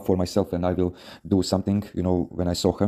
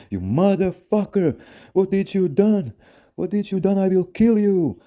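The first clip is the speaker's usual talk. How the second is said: high, slow screaming rage; furious yelling boyfriend; 12 semitones higher, 4 dB louder than usual. Retold or imitated. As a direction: scream it like a jealous boyfriend about to fight. Angry boyfriend confronting him.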